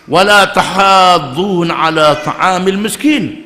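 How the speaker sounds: slow, drawn-out Arabic chant; measured melodic recitation; Quran recitation. Acted. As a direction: intone the verse like tilawah. Quran verse recited in sermon.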